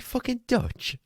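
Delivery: high-pitched retold disbelief; incredulous echo. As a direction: squeak the question back, baffled. Retold disbelief at being thought Dutch.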